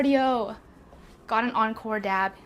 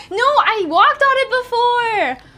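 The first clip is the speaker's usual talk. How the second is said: loud, high, drawn-out wail; indignant protest; 10 semitones higher, 9 dB louder, slower than usual. Real, not acted. Streamer defending her treadmill use to chat, wailing in protest. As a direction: protest slowly and loudly, stretching the words.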